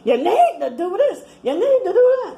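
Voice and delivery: high, loud, laughing mock bossiness; bossy advice voice. People lecturing her on what to do, a high mocking bossy voice. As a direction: mimic the know-it-alls ordering her around. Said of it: "they try to tell me".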